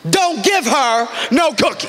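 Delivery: high, slow, drawn-out command; scary stern mother. Retold or imitated. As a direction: forbid it from across the house, stretching each word. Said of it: "It scared me".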